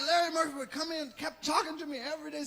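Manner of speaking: normal talking voice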